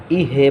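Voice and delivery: ইহে with an extra e vowel after h; released jazm, wrong. Shown as a demonstration. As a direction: let the h open into ইহে. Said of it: "ইহে বলা যাবে না". (জজম ছেড়ে ইহে বলা)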